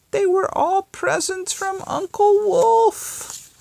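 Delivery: very high, loud mother hen voice; mother hen calls her chicks about Uncle Wolf; screeching hen. Acted. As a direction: squawk it out like an excited hen. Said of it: "the chicken screeched".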